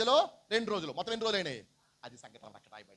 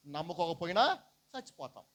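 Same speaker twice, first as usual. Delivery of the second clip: normal talking voice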